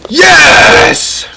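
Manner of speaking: triumphant yell of yes; excited shout. Real, not acted. Celebrating a putt.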